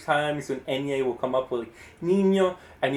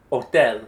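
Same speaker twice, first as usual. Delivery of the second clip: Hotel called out louder as o-TEL; final-syllable stress; 3 semitones lower, 5 dB louder than usual. Shown as a demonstration. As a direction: punch the last syllable of Hotel. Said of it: "I'm going to say a word".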